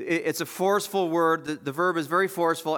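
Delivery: usual speaking voice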